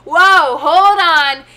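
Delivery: loud, high "whoa, whoa", reining someone in; a retold loud, high-pitched yell of alarm; alarmed mock shout. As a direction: shout it out like a startled friend pulling back. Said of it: "and he goes".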